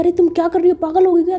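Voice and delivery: startled, exasperated outcry; alarmed scolding. A narrator's reading. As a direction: scold a friend who just fell. Narrated scolding after the friend falls.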